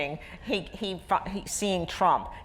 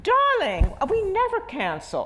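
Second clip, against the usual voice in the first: a lofty society hostess tossing off "Darling"; grand socialite; 5 dB louder than usual. Retold or imitated. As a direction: imitate the grand old lady, breezy and unflappable. Socialite's grand reply voiced.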